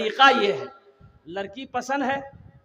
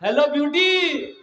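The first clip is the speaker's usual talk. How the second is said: mocking lover boy; 7 semitones higher, 5 dB louder, slower than usual. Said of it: "वो लड़का phone कर रहा है".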